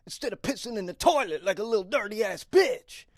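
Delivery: high, loud furious shouting rant; an angry stranger screaming insults in a bathroom. Retold or imitated. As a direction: rip into him, yelling. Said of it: "And he goes".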